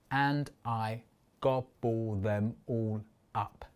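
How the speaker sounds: slow, low, spaced-out "And I gobble them all up"; syllable by syllable. Shown as a demonstration. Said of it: "I need to add one".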